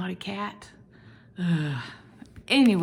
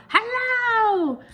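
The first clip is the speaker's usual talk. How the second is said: much higher, louder cheery hello; friendly letter Y; 5 dB louder than usual. Acted. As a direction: greet like a waving cartoon letter. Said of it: "Y is very friendly".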